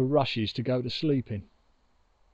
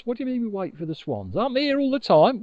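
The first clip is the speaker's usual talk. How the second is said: incredulous; 7 semitones higher, much faster than usual. A narrator's reading. Moon incredulous about swans.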